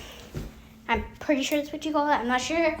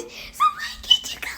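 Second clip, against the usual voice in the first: exasperated squeak. Real, not acted. Mock exasperated question at the adult.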